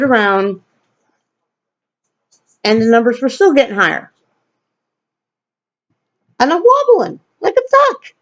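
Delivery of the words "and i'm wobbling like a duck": squeaky comic voice. Acted. Comic self-description, voice jumps.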